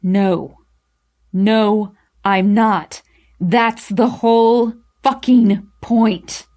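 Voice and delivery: loud, slow, emphatic anger; very angry. A narrator's reading. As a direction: hammer out each word angrily. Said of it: "very angry".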